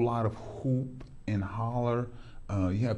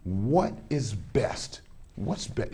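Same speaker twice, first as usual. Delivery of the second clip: ordinary talk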